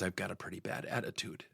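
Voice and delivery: very soft, subdued retold confession; retold cracking-voice admission. Sales rep sheepishly admitting fault to his coach, imitated cracking voice. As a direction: let the admission barely come out, low and hushed. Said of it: "his voice cracks".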